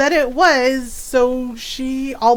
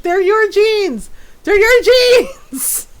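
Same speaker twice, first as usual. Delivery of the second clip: high, loud, slow mock indignant yelling; hammed-up blame, building louder; mock angry accusation; 6 semitones higher, 3 dB louder, slower than usual. Daughter blaming mom, mock angry yell growing louder. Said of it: "she was so mad though".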